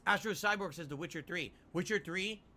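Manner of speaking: usual speaking voice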